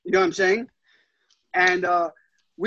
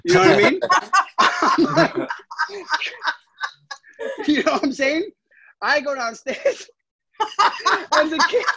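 laughing; 7 semitones higher, 3 dB louder than usual. Real, not acted. Laughing hard while telling story.

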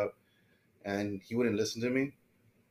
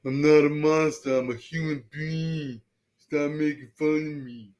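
strained, high-pitched plea, slow; movie quote voice; 4 semitones higher, 6 dB louder than usual. Retold or imitated. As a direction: beg them to stop teasing you. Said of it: "Who was that?".

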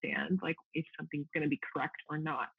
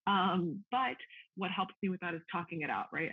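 ordinary talk

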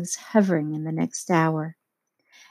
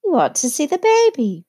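gushing; 9 semitones higher, 3 dB louder than usual. A narrator's reading. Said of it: "she added irrelevantly".

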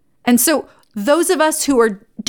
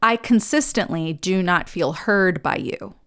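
slow, measured and softer; precise calm statement; 3 semitones lower, 5 dB quieter than usual. Shown as a demonstration. Coach models the right way to tell a partner, slow and calm. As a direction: say it carefully, owning the feeling. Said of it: "Here's how you say that precisely".

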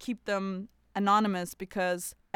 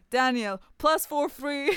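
jokey announcement; 6 semitones higher, 6 dB louder than usual. Pretend. Jokingly announces a man's phone number.

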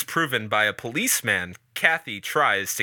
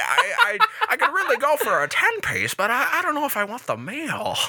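indecisive customer; 10 semitones higher than usual. Pretend.

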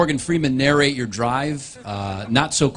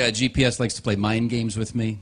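usual speaking voice

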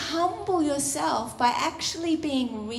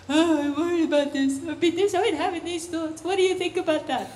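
quicker, fretful rush of worries; anxious believer; 2 semitones higher, faster than usual. Acted. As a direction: pour out worries in a hurry. Acting a worried believer praying.

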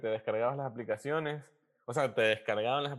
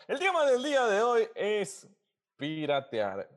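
high, slow announcer build-up; show host announcement; 8 semitones higher, 3 dB louder, slower than usual. Acted. Announces topic of the episode. (anuncio de presentador de programa)

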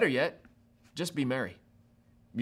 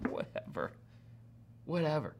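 dismissive; 9 dB quieter than usual. Acted. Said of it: "She's like".

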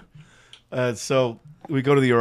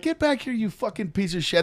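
retold angry challenge; 8 semitones higher than usual. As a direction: call him back for a fight. Retold chase after doctor.

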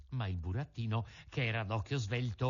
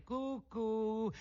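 high, sing-song taunt; high teasing call; mocking; 9 semitones higher than usual. A narrator's reading. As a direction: taunt them with a cuckoo call. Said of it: "in segno di canzonatura".

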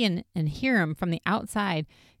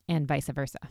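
usual speaking voice

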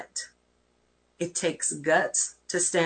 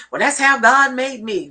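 louder, defiant and sassy; sassy excuse; 5 semitones higher, 8 dB louder than usual. Retold or imitated. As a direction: throw it back with attitude. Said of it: "people that are just messy".

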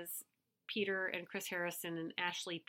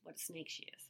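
whisper; 3 semitones lower, 13 dB quieter than usual. Pretend. Gossipy aside.

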